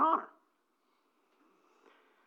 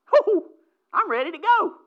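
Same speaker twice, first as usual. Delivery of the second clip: eager exclamation; 9 semitones higher, 6 dB louder than usual. Real, not acted.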